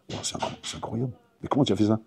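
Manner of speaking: chuchoté, rapide, ébahi; émerveillement chuchoté. Acted. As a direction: souffler son étonnement. Le paysan ébahi devant le tour. (paysan ébahi qui chuchote)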